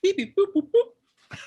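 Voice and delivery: high robotic beeping; droid beeps. Pretend. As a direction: beep like a little astromech droid. Droid beeps as self-introduction.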